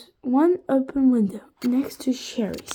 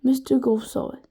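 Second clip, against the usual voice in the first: plain reading voice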